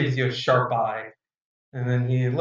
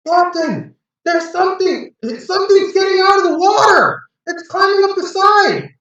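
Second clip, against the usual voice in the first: high, loud yelling to the captain; a sailor screaming warning to his captain; panicked yell; 8 dB louder than usual. Acted. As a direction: shout the alarm over the rail. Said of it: "he starts yelling".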